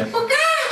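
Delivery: very high-pitched screamed swear; mock terrified shriek. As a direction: imitate a woman shrieking at a tarantula. Imitates woman swearing at the tarantula.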